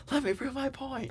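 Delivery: very high, soft squeal of protest; mock tantrum-throwing toddler. Imitating a wrestler being dragged out, mock toddler tantrum. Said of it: "like a tantrum throwing toddler".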